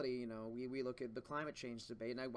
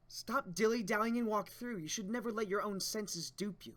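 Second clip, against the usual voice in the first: louder, higher voices for the manga characters; manga characters voiced; 8 semitones higher, 8 dB louder, slower than usual. Reader voicing characters in the lab scene, lively and higher. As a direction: act out the panel dialogue with energy.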